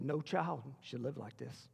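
slow, low and soft, grief held back; understated sorrow, slowly spoken; retold sobbing plea. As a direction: quote her grief in a hushed, low voice. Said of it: "I mean just sobbing".

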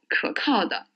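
normal talking voice

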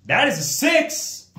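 high, loud cheer at a six; excited shout. Real, not acted. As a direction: shout with joy at the roll. Excited at dice roll.

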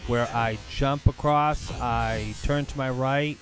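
slow, emphatic play-by-play over a replay; replay commentary. Acted. Gamer narrates his slow-motion replay to viewers, emphatic commentary. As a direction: punch out each move deliberately, loudly in places.

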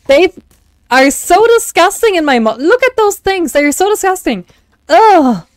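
high disgusted shrieking at spiders; screaming at a spider. Real, not acted. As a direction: squeal in horror at the spider. Sees spider, arachnophobia.

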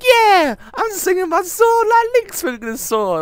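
very high, loud, hammed-up cheer; over-the-top gamer shout; mock excited yell. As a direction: yell like a kid waving a remote. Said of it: "you're in the phone, like".

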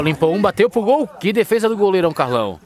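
rising, excited play-by-play; excited shot call. Real, not acted. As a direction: build up as he shoots. Shot on goal, big save.

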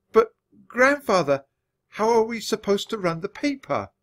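high, flustered protest. A narrator's reading. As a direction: plead in alarm. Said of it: "I stammered".

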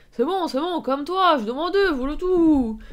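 loud, high, sing-out retort; irritated protest. Acted. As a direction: protest loudly at being told off. Voices man protesting at insult. (protestation agacée, forte et aiguë)

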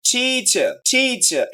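Teacher as TEEE-cher, falling; long first syllable. Shown as a demonstration. Teacher demonstrating length, drawn out. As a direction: hold and stress the TEE in teacher. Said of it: "long".

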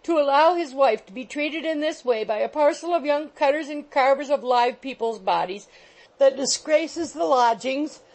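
high, loud mock-tearful wail; sobbing. A narrator's reading. Landlady sobbing loudly to rouse her husband. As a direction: wail it through sobs to shame him. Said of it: "Here Missus Rattle sobbed".

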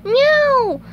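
very high, loud wail of a meow; Gary's protesting meow. Acted. Snail not wanting his owner to leave, high protesting meow. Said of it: "Gary protested".

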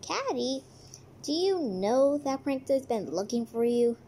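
usual speaking voice

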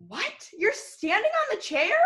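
teacher acting shocked at a child on a chair; mock shock. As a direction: act shocked a kid is on the chair.

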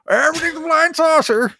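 put-on high, excited old man voice; an old-timer marveling at the sky. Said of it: "a nineteen fifties kid with my fucking space helmet going".

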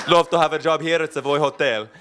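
put-on a fuller Italian accent with a laugh behind it. Lorenzo asking for a job at the Savoy in Italian accent. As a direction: redo the line in Italian accent, a touch stronger. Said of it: "It's actually perfect".

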